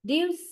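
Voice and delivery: deuce picked out high as the stressed part; deuce, the stressed end of produce, said alone and high; stressed second syllable. Shown as a demonstration. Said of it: "means the second syllable".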